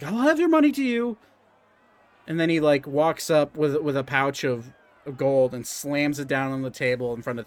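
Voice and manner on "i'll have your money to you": hurried high reassurance. Acted. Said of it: "It's like".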